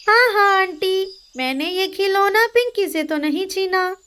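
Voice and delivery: put-on high boyish bossy voice; a little brother grabbing a toy; child voice. Older brother demanding the toy from his sister, childish high voice. Jaggu boy voice.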